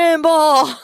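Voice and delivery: shouted, high and loud; playful shout. Real, not acted. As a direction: yell it out for fun. Jokey shout at news.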